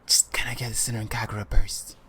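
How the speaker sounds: hushed whisper, keeping a request secret; furtive whispered request. Retold or imitated. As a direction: whisper it discreetly across the counter. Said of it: "he's whispering to the guy".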